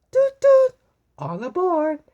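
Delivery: very high, loud toot toot then all aboard; train conductor call. A narrator's reading. Conductor Rotosaurus calling passengers aboard, loud and high. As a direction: sing out like a train whistle. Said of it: "announced Conductor Rotosaurus".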